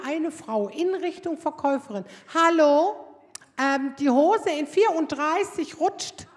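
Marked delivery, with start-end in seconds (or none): 2.3-6.3 loud call, slow; yelling across changing room. Retold or imitated. Woman in the next changing room yelling for the saleswoman, loud. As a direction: bellow to the saleswoman. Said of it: "brüllt".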